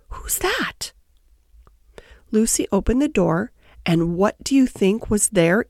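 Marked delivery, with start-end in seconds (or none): 0.1-0.9 small, high, soft startled call; little frightened voice. A narrator's reading. Someone inside answers Lucy's knock, little frightened voice. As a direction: call out timidly in a high, quiet voice. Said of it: "A little frightened voice called out".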